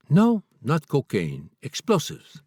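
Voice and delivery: a hint of a Chinese-accented guard correcting him; heavy Chinese accent. Guard corrects the author, a hint of Chinese accent. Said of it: "He counted again in his heavy accent".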